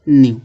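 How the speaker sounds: न्यू said alone as "nyoo", short and falling; model pronunciation. Shown as a demonstration. Teacher modelling न्यू for learners, crisp and falling. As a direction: say न्यू crisply as one clean syllable. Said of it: "न्यू इस तरह लिखा जाएगा".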